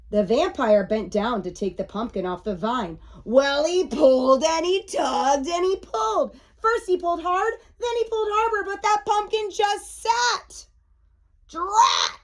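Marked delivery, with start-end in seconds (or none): 3.2-10.7 climbing higher with each pull; animated storybook build-up; building excitement. A narrator's reading. Narrating a mounting tug of war. 11.5-12.2 high-pitched burst of frustration; cartoonish exasperation; frustrated exclamation. A narrator's reading. Vampire fails to lift pumpkin.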